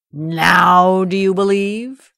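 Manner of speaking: slow, loud booming lion; lion roar voice. Acted. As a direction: roar it triumphantly. Ogre as lion roars.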